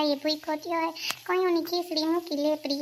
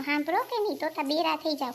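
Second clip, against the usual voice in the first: usual speaking voice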